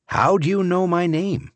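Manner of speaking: startled driver asking the greeter; surprised question. A narrator's reading. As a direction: catch him off guard. Narrator asks surprised.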